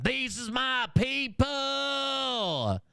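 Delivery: high, slow, wailing mock Jesus; hammed-up Jesus lament; mock Jesus cry. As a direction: cry it out like a preacher in anguish. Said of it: "While Jesus is over here saying".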